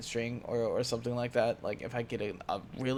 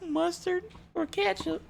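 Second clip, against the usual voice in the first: mock southern accent; 3 dB quieter than usual. Mocking his own southern accent while listing condiments, hammed-up southern. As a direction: ham up a southern accent for laughs. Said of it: "I hate when my southern accent comes out".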